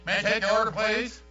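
the box asking politely for the first time; polite drive-thru voice. Retold or imitated. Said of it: "I hear the kids saying".

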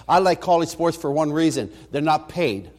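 ordinary talk, nothing marked

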